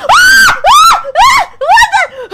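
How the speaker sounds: high shrieking screams; scared screams. Real, not acted. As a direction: scream in fright. Jump scared by a player.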